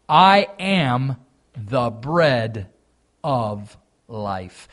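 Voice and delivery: I AM THE BREAD drawn out loud, dropping on "of life"; solemn declaration. Acted. Preacher declaims the key verse.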